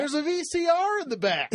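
high, eager family-van voice; excited joke. Pretend. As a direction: pitch it up like a dad. Joking about minivan.

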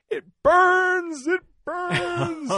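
high, loud shriek of pain; retold scream. Marine with chem light in his eye screams in pain. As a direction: yell it like your eyes are on fire. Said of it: "starts screaming".

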